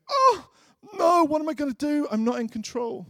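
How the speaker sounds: high wailing cry of alarm; hammed-up panicked wail; mock panic. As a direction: squeal it like you've lost control. Mock panic, not in control.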